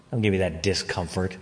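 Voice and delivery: ordinary delivery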